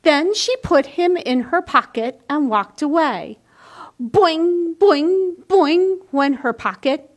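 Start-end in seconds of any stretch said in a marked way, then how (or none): 4.0-6.0 boing, boing sung out higher and louder like a spring; bouncy boing sound. A narrator's reading.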